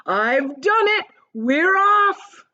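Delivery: high, loud, slowing victory yell; grinning centipede announcing success; triumphant shout. A narrator's reading. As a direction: crow it from the ceiling. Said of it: "and shouted".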